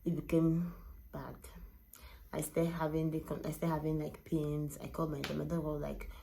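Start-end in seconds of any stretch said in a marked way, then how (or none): 1.1-1.4 whisper. Real, not acted. Turning point of miscarriage story.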